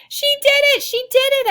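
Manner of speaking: very high, loud victory squeal; excited squeal. A narrator's reading. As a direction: squeal with joy at a friend's win. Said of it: "I squeal".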